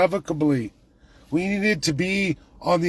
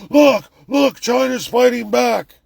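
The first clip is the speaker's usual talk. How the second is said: higher, louder mock excitement; mocking media voice; 8 semitones higher, 6 dB louder, slower than usual. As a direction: parody the hopeful news anchor.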